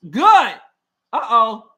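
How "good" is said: a sudden loud burst of approval; a fan's loud, happy shout; excited cheer. Real, not acted. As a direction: call it out loud like a fan. Cheering a good defensive play.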